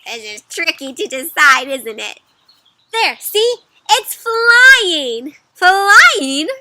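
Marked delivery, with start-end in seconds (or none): 2.9-5.4 high, loud triumphant shout; stretched, excited storybook exclamation; triumphant proof. Acted. Duck believer shows proof. 5.5-6.5 loud, high questioning echo; rising, hammed-up astonishment; incredulous echo. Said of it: "says the other person".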